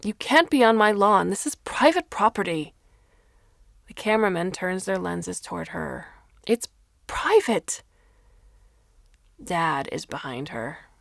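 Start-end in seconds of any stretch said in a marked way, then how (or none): 0.0-2.7 loud, high furious mother; angry scream. A narrator's reading. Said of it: "she screams". 6.5-7.8 high, slow, pleading insistence; a mother at the end of her rope; insistent yell. A narrator's reading. Mother yelling at reporters.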